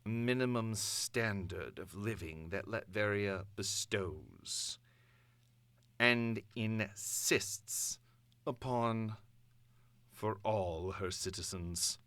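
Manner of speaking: measured, imperious villain monarch address; Doom's broadcast. Acted. Doom addressing the conquered nations by broadcast, imperious and cold. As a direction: proclaim it like a conquering tyrant, cold and grand.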